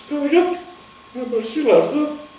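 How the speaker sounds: voice breaking. Real, not acted. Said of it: "Okay, excuse me".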